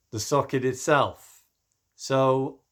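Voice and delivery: hoarse, strained voice; rough, worn-out voice from talking. Real, not acted. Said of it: "I'm losing my voice".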